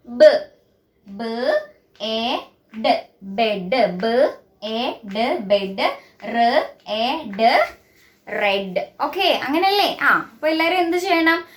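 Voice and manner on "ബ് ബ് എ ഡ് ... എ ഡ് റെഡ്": "ബ്, എ, ഡ്" in separate sounds, then blended "ബെഡ്"; low, spaced sounds, each set joined into "ബെഡ്" or "റെഡ്"; phonics sounding out. Shown as a demonstration. Teacher gives pupils the sound of B, sounding out each word. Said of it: "ബിയുടെ sound ഏതാണ്?".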